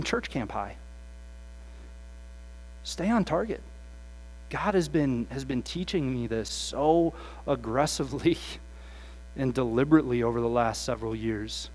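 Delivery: normal talking voice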